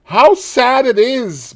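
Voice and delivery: high, slow and sorrowful, each word drawn out; a high, slow sigh of grief, not a murmur; sad, slow lament. A narrator's reading. Dorian grieving before his own portrait, slow and high. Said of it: "murmured Dorian Gray".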